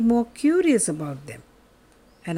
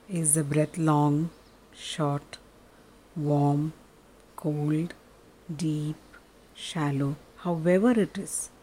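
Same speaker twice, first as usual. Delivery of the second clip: soft, lower, unhurried meditation voice; guided meditation; 4 semitones lower, 5 dB quieter than usual. Acted. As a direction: guide them gently into stillness.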